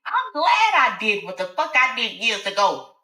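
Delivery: high, spiteful reveal; gloating Alice. Acted. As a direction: turn the tables with relish. Alice turns the tables.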